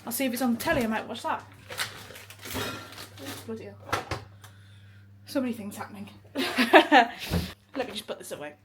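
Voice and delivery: usual speaking voice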